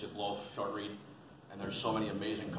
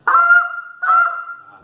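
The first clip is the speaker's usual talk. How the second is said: goose call honk; 19 dB louder than usual. Shown as a demonstration. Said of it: "Get the basic".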